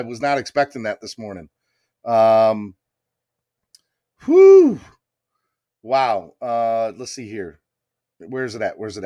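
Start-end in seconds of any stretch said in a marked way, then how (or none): 4.2-4.8 high, loud blown-out whew; a sharp, high whoop of relief; excited whew. Real, not acted. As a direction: exhale big, overwhelmed with joy. Overwhelmed by the big donation.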